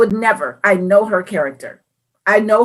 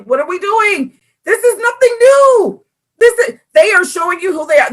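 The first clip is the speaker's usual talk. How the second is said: high, raised scolding in imitation; exasperated sister; 9 semitones higher, 3 dB louder than usual. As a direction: scold in mock exasperation, drawing it out.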